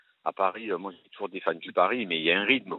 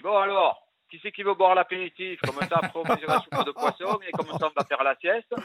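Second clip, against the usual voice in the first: cliché Marseille character; 8 semitones higher than usual. Retold or imitated. Typecast actor mocking his stock role, high and hearty. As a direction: ham up the jolly pastis-and-siesta Marseillais. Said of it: "j'arrive à la deuxième page et je dis".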